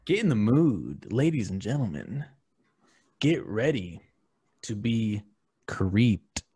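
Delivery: slow, drawn-out announcing; hammed-up invitation to be scared; spooky showman intro. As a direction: draw it out like a horror host. Theatrical horror intro to listeners.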